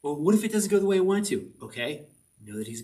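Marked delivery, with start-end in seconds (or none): 0.0-1.5 anxious retold question. Retold own anxious reply.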